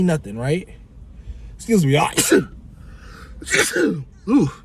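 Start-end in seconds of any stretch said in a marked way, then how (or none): 3.2-4.0 sudden high-pitched sneeze; real sneeze. Real, not acted. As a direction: sneeze in the middle of talking. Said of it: "had to, like, sneeze".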